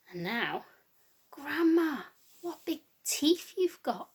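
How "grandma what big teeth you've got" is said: higher, slow, drawn-out wonder; wondering little girl. Acted. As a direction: marvel at it slowly like a child.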